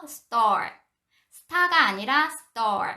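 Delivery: store slow, the t tensed like ㄸ; tense t in store. Shown as a demonstration. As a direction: stretch store with a hard ㄸ. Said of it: "띠귿 발음이요". (store, 쌍디귿 발음으로)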